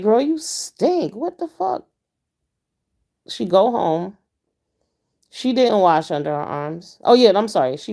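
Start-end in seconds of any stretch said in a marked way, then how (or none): 0.0-1.9 high, slow, appalled; disgusted. Retold or imitated. Driver telling rider she stinks, slow and appalled. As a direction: drag out the disgust, then squeak it up high. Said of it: "And I was like".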